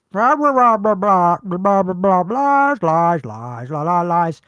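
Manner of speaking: mock the politicians' empty talk out loud; mocking blah-blah. Brushing off politicians' lies with loud mocking babble.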